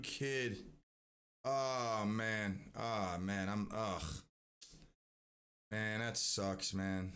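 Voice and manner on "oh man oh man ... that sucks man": low, slow, grieving; sad. Real, not acted. Reacting to child's death.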